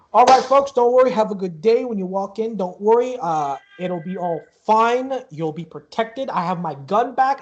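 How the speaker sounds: high, loud showman's patter, slowing down; reassuring ticket seller. Acted. Ticket seller sends guests in, hearty reassurance.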